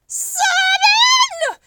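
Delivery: very high, loud screech. Acted. Pelican hearing about salmon, screeching wildly. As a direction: screech it out in disbelief. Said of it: "screeched the pelican".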